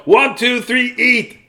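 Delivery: high, loud countdown shout; judge's yell. Acted. Judge yells to start the pie eating, high and loud. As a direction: call the start like a contest judge. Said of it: "the judge yelled".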